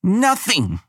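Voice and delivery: put-on high, loud girl spitting the word Nothing; spat defiance. Abigail spits her defiant answer at Elena, high and loud. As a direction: spit "Nothing!" in her face. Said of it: "she spits".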